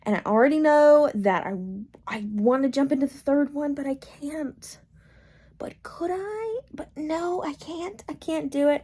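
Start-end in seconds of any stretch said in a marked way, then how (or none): 3.8-8.8 hushed whisper, fighting temptation. Real, not acted. Vlogger whispering to herself about the next book, hushed. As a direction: whisper it like a guilty secret.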